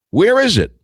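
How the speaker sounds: where is it. Real, not acted. Exasperated searching for missing info.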